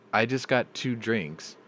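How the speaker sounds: slow, deliberate dawning realization; slow disbelief. Retold or imitated. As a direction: spell it out slowly in disbelief. Realizing he drank a liter.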